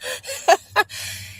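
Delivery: real laugh at the memory; high burst of laughter. Real, not acted. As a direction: let out a high delighted laugh. Reacting to the title.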